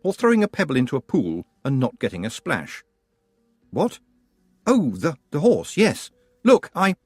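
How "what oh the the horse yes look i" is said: higher, stumbling surprise; flustered. Acted. Tiffany caught out by Granny, mildly flustered. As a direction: caught off guard, words tripping.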